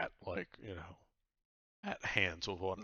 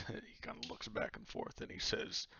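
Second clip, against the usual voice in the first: ordinary talk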